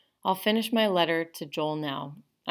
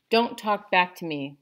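louder, firm order to stop; Dad's shout; 3 semitones higher, 7 dB louder than usual. A narrator's reading. Father scolding his son for talking back, loud. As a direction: cut the boy off sharply. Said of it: "Dad shouted".

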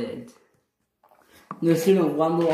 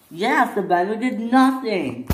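higher, raised denial; protesting innocence; 7 semitones higher than usual. Acted. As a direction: deny it indignantly. Twins deny crashing on purpose.

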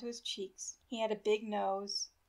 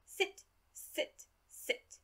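put-on curt German-accented orders; old German doctor repeating a short command; thick accent; 9 semitones higher, much slower than usual. A narrator's reading. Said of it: "in his thick accent".

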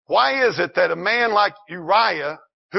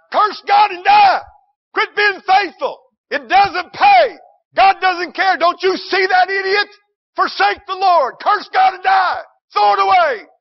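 screaming taunt, much higher and louder; mocking world's voice; 8 dB louder than usual. Preacher voices the world's taunt in a shrill scream. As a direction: shriek it like a scornful tempter. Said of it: "the world will say".

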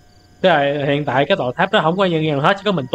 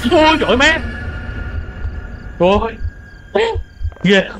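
startled yelp; 8 semitones higher than usual. Real, not acted. Streamer jolted by a horror game scare, startled yelp. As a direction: cry out as if jumped at.

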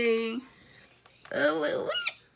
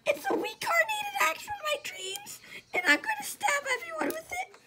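high crazed voice; much faster than usual. Pretend.

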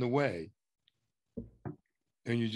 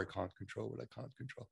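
quiet mumble; 15 dB quieter, faster than usual. Shown as a demonstration. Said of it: "I want you to say".

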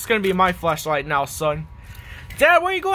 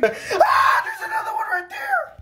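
panicked high shriek. Acted. Dad spots another drain and shrieks in panic. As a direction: screech the words high in terror.

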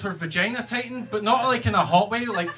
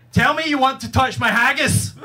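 louder, higher mock command; insistent demand; 6 semitones higher, 5 dB louder than usual. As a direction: imitate her demanding it forcefully. Imitates her insistent demand in bed.

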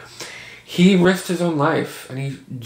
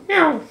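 high little imitated meow; mock meow; 14 semitones higher than usual. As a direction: squeak out a cat meow. Imitating cat.

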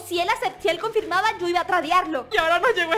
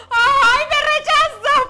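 much higher and louder, a sobbing wail; crying wail; 9 semitones higher, 8 dB louder, much slower than usual. Real, not acted. Vlogger wailing after a rejected trade, half crying. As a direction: wail as the hope is snatched away.

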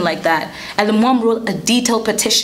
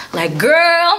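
exasperated; 6 semitones higher than usual. Real, not acted. Exasperated aside to viewers.

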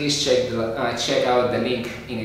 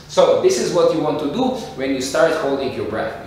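normal talking voice

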